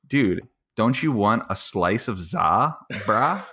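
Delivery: slow, spelled-out slang offer; exaggerated repetition. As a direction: drag it out for someone not getting it. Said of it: "to the point where I had to say".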